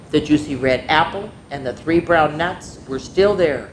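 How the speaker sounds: ordinary delivery, nothing marked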